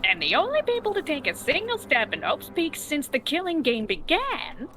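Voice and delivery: theatrical character read with big pitch swoops; game character voice. Acted. Reading the killing game recap aloud, in a put-on character voice. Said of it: "I still like doing the voice".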